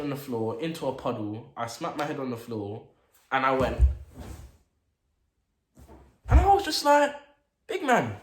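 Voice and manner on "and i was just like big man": squeaky high, loud indignant yelp; mock outrage. Retelling the fight to viewers, high indignant yelp. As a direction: yelp it in disbelief.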